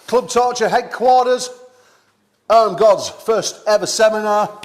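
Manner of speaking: high, loud, hyped-up host opening; event announcer intro. Real, not acted. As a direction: announce it big to the crowd. Host opens the live seminar.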